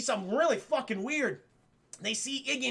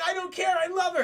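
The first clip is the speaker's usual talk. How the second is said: defiant shout; 8 semitones higher, 4 dB louder, faster than usual. Retold or imitated. Luigi defiant, insists on going.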